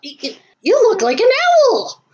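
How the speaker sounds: high, stammering amazement; astonished. Acted. Sammy stares at his friend's owl costume, astonished.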